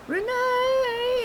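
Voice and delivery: high, long drawn-out call of the name; imitated holler. Cat calling the name from the porch, long high holler. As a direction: call the name like the sister would. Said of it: "I kept hearing".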